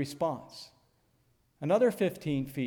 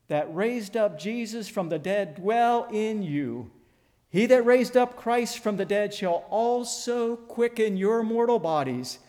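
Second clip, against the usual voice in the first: rising preaching; 4 semitones higher than usual. Acted. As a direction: build the verse up to a ringing climax. Preacher builds the scripture.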